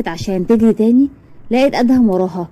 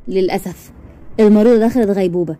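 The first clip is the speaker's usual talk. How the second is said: ordinary delivery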